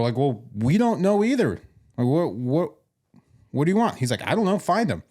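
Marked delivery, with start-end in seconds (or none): 0.2-4.0 high-pitched, exasperated retelling; mock exasperation. Retold exasperated reply to clueless staff. 4.3-5.0 high-pitched, dismissive retold reply; acted brush-off, pitched up; mock dismissive. Retold staff member's dismissive answer.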